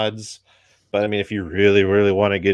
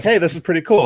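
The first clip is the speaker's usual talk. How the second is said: higher, louder imitated enthusiasm; mock impressed viewer; 9 semitones higher, 8 dB louder, faster than usual. Guessing how casual viewers reacted, higher, louder mock enthusiasm. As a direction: act out a fan's pleased reaction. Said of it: "I'm sure it was like".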